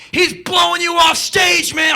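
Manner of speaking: high, loud heckling shout; mock crowd yelling taunt. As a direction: yell it over the band. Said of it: "People are going".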